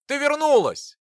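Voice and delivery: high, loud and delighted; joyful. Acted. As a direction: beam with happy relief. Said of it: "обрадовался я".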